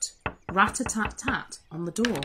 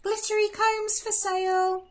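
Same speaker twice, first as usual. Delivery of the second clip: put-on much higher girlish voice; sweet high peddler girl; the disguised queen as a girl; young girl calling; 13 semitones higher than usual. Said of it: "a young girl call out".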